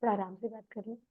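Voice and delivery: quiet reassuring plea; soft, at ease. Shown as a demonstration. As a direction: soothe someone who is shouting. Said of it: "वो चिल्ला रहा है".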